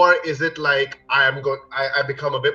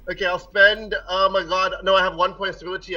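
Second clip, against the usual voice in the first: ordinary talk, nothing marked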